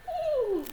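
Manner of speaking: very high imitated neigh; whinny like a horse, high and playful. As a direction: make a high horse noise for kids.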